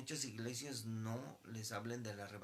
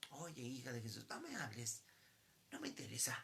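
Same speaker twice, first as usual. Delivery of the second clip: dismissive mother; 3 dB quieter than usual. Retold or imitated. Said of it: "la mamá supuestamente le habla a la hija".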